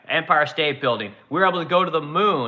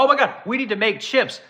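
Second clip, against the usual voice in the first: a higher, sarcastic put-on gush; mocking gasp; 6 semitones higher than usual. Host mocking politicians who now want chips, sarcastic high gush. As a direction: parody people suddenly discovering chip-making.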